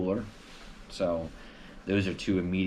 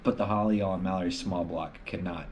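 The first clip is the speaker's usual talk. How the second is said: usual speaking voice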